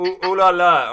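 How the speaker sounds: loud playful French tease; hammed-up French flirt; flirty ooh la la.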